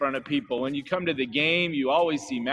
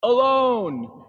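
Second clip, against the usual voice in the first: lightly voiced boy, higher and louder; boy's lonely answer; 8 semitones higher, 7 dB louder than usual. A narrator's reading. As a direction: say it like the shy little boy in the book. Boy's line in read-aloud book.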